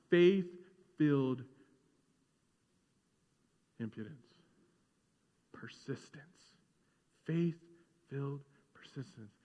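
a hushed preacher's emphasis. Acted. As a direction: let the phrase sink in quietly. Preacher lingers on key phrase.

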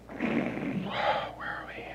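unvoiced aside; whisper to self. Real, not acted. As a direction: mutter it under your breath. Whispering to himself, lost place.

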